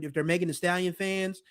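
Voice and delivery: ordinary delivery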